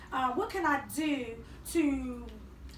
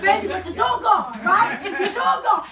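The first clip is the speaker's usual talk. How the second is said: laughing mock parent; 5 semitones higher, 10 dB louder than usual. Trainer plays parent answering teen.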